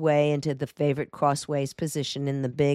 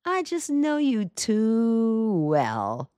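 very slow, higher, lazy drawl; 7 semitones higher, much slower than usual. A narrator's reading. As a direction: stretch every word out, amused. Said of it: "His slow drawl was expressive".

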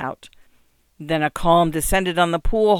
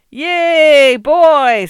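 much higher, much louder whoop; yelling; 10 semitones higher, 12 dB louder than usual. A narrator's reading. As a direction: jeer him with a hearty holler. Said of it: "someone yelled".

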